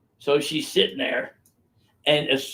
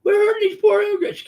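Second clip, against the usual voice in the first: mocking whiny voice; 6 dB louder than usual. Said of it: "everybody says".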